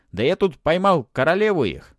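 high, loud boast; mummy bragging with malicious relish; sly gloating. A narrator's reading. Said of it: "мумия ехидно ощерилась".

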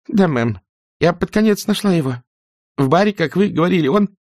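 put-on high, timid young servant girl; frightened maid voice. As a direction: squeak it out nervously. (испуганная служанка)

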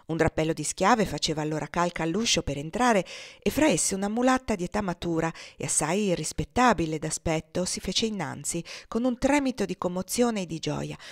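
plain reading voice

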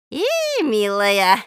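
put-on slow, gentle old granny greeting; kindly old woman. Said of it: "ласково ответила старушка". (ласково, по-старушечьи, медленно)

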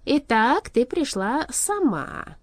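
pleased king, loud and lingering; satisfied. A narrator's reading. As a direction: draw it out with satisfaction. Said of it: "удовлетворённо произнёс король".

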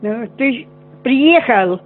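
ordinary delivery

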